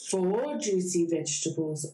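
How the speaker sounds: usual speaking voice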